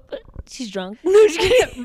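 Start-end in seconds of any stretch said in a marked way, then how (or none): 1.0-1.8 laughing. Real, not acted. Laughing joke.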